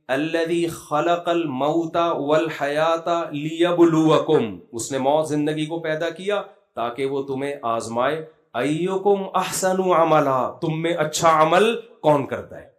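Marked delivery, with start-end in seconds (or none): 0.1-4.6 slow, drawn-out chanted recitation; Quran recitation. Acted. Preacher quoting the Quran to his audience, slow chanted recitation. 8.5-10.5 slow, drawn-out chanted recitation; Quran recitation. Acted. Preacher quoting the Quran to his audience, slow chanted recitation.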